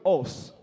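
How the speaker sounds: "us" said as "oss"; rounded o in "us"; Nigerian pronunciation. Shown as a demonstration. Comedian shows Kenyans the Nigerian way, "us" as "oss". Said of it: "in Nigeria we call it".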